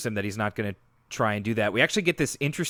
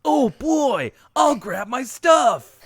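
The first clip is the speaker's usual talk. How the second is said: cheesy Link voice; 11 semitones higher, 5 dB louder, much slower than usual. Retold or imitated. Continuing the CD-i king bit.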